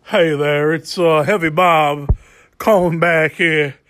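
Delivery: normal talking voice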